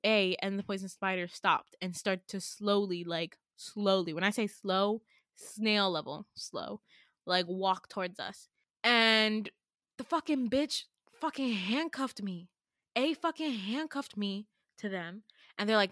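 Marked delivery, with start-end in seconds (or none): none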